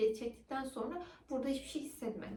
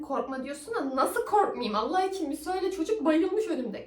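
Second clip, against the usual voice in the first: scared protest; 5 semitones higher, 6 dB louder than usual. Retold or imitated. Retorts to the doctor in fear.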